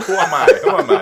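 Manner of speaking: high, loud, laughing wail; mock identity crisis. Friend piling onto the lost-accent joke, mock-despairing and laughing. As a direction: cry it out in comic despair.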